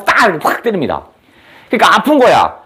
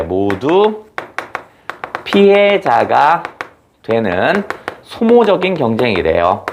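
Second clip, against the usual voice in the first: slow emphasis; 4 semitones lower, much slower than usual. Shown as a demonstration. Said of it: "teacher repeats key phrase slowly".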